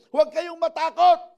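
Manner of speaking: loud, high call to the crowd; Moses proclaiming. Acted. Preacher quoting Moses to the congregation, loud proclamation. Voices Moses addressing the people.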